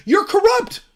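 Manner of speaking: high, loud sarcastic accusation; mock accusation. Mocking the accusers, high sarcastic jab. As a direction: sneer it back.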